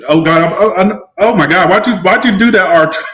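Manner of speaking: fighting not to break down; real, flustered emotion; choked up. Real, not acted. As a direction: voice rising, holding back tears. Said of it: "I am not having a moment".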